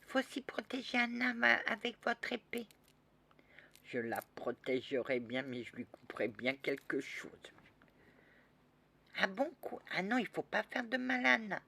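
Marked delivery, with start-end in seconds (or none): 0.0-2.7 put-on high child voice, insisting; little girl pleading. 9.2-11.6 put-on high child voice, protesting; alarmed little girl. Child defends Anna.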